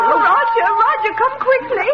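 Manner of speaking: high, urgent cry for help; urgent call. Acted. Dog poisoned, panicked call to nephew.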